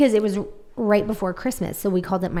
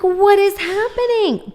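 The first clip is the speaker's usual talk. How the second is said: higher, louder and slow, baffled; mock bewildered; 13 semitones higher, 6 dB louder, slower than usual. Retelling her panic to a friend, hammed-up baffled cry. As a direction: stretch it out in disbelief. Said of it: "And I'm like".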